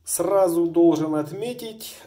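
normal talking voice

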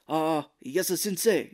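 loud mock-Japanese accent; fake Japanese accent. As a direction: imitate an eager pupil in bad Japanese accent. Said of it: "why their Japanese is so bad".

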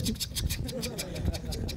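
soft unvoiced clicking sound imitation; clicking noise. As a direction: click it out under your breath like a crank window. Mimics window opening sound.

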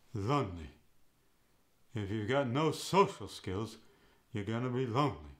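"Lonely." said "lunly" with uh; Yiddish O. Shown as a demonstration. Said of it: "No and lonely go to".

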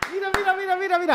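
high, excited urging. Real, not acted. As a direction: point at it giddily. Excited replay. (emocionado, agudo)

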